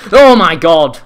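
loud startled cry; startled gamer outburst; loud exclamation. Real, not acted. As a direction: blurt it out. Shocked in game.

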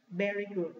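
ordinary talk

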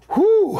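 a loud, high whew of amazement; big surprised whew. Real, not acted. Host reacting to a bid, loud high whew. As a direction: blow out a loud high whew as the price jumps.

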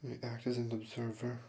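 soft, calm meditation guide with long pauses; hushed, even-pitched instruction, leaving space between phrases; guided meditation. Acted. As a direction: gently lead them into awareness of the breath. Leads a breathing meditation.

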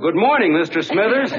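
loud, high bellow across the hedge; neighbor-waking yell. Retold or imitated. Shouting a greeting across the hedge at a deaf neighbor, bellowed loud and high. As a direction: yell it so the next county hears. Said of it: "in a voice you could have heard in the next county, I yelled".

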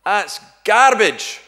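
sudden loud, high, slow yell from the back; drawn-out shouted heckle; heckler's shout. Retold or imitated. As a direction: bellow it out like an angry heckler. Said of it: "this voice shouts out from the back".

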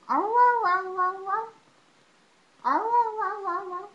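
high, loud yelping howl to get the dog going; mock dog howl. Shown as a demonstration. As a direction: howl like a dog to set him off. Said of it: "make your funny noises".

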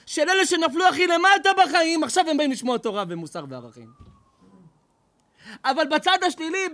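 usual speaking voice

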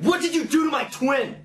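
hammed-up angry shout, very high; loud, over-dramatic angry imitation; all angry. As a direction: yell it in mock outrage. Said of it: "when you're, like, all angry".

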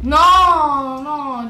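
loud high dismayed cry; shouting no. Real, not acted. Reacting as bed falls.

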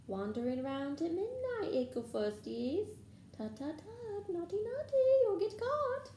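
high, singsong teasing cackler; Peeves. A narrator's reading. Poltergeist teasing students caught out at midnight, cackling singsong. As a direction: taunt them in a high, gleeful singsong. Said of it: "Peeves cackled".

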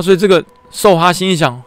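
ordinary talk, nothing marked

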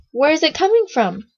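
high, loud curious question; puzzled wonder. A narrator's reading. Guide looking about for the music, surprised question. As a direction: search for the sound, pitch up.

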